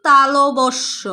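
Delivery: তালবস্য drawn out slowly, each syllable clear; slow careful demo. Shown as a demonstration. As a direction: spell out the correct pronunciation slowly. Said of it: "ওর সঠিক উচ্চারণ". (ধীরে ধীরে স্পষ্ট উচ্চারণ)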